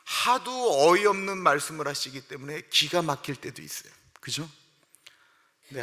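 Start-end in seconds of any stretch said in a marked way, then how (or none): none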